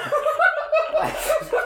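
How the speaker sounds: high-pitched real laugh; burst of laughter. Real, not acted. Player reacts to weird shopping story, high laughing burst.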